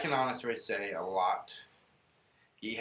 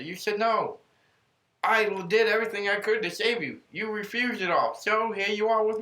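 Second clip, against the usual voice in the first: God explaining; 8 semitones higher, 3 dB louder than usual. Retold or imitated. God answering the dead man.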